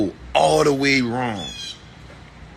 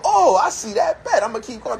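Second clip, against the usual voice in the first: sudden realization; 8 semitones higher than usual. Retold or imitated. Voicing someone's inner realization.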